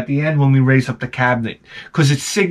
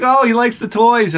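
higher-pitched voice for the seller; imitated older woman; 8 semitones higher than usual. Seller to dad about his son, imitated woman. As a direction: lightly mimic a woman's cooing remark.

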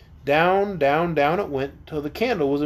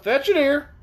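raised-pitch calling voice; shouted; 9 semitones higher than usual. A narrator's reading. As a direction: call down for it to be brought back. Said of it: "shouted Cap'n Bill".

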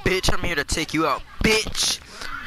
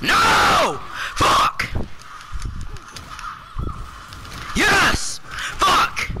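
rage screaming; much slower than usual. Real, not acted. Gamer swinging between hope and fury, screaming. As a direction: explode on each single word. Raging at the game.

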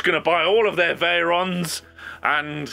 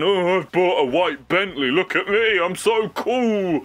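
smug footballer; 2 semitones higher, slower than usual. Retold or imitated. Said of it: "self-obsessed person".

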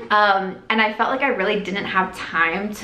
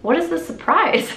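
confused surprise; 8 semitones higher than usual. Retold or imitated. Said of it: "I turned to Naya and I said".

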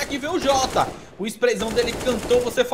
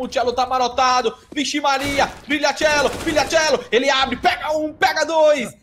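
punchy calls, slow stretched finish; building play-by-play; 5 semitones higher, 6 dB louder, slower than usual. Real, not acted. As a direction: drive up through each kill and draw out the payoff. Narra sequência de abates. (narração crescente e esticada)

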